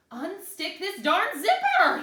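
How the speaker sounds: high, loud, slow frustrated scream. Acted. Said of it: "he screamed".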